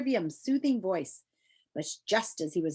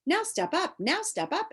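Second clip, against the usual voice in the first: much higher, coaxing advice; high recommending call; 9 semitones higher than usual. Acted. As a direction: coax them to step up, high. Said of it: "it recommended".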